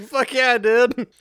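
high, loud boast; triumphant Bob. Retold or imitated. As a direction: brag with a cheer.

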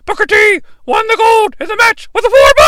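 high, loud wrestling announcer impression; Tony's commentary voice. Mimicking Tony naming the title match, high excited announcer call. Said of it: "which is what Tony refers to it as".

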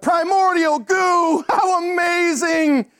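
high, loud, drawn-out mock awe; hammed-up shout of wonder; mock exclamation. Preacher mocking a reaction he never had, hammed-up amazed shout.

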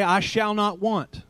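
"I shall not want" slow, NOT stressed; slow firm claim. Shown as a demonstration. Preacher giving the congregation words to say, NOT stressed slowly. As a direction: plant the word NOT slowly. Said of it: "so you can say".

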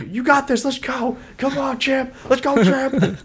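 high, pleading pep talk; coach cheering. Retold or imitated. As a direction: pump up a hurt fighter. Coaches picking up beaten fighter.

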